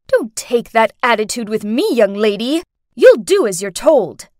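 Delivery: mother's loud, firm telling-off; stern scolding. Acted. Mother scolds her daughter.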